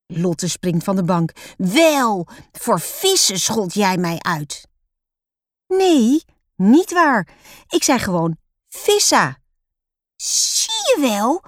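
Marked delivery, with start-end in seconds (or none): 1.6-4.7 put-on slow, insistent child's accusation; angry accusation. A narrator's reading. Lotte accusing her sister in front of papa, angry. 5.7-9.4 put-on high, loud child's protest; indignant denial. A narrator's reading. Roos defending herself against Lotte's accusation, indignant. 10.2-11.4 put-on very high, drawn-out child's yell. A narrator's reading. Lotte telling on her sister to papa, yelling. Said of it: "gilt Lotte".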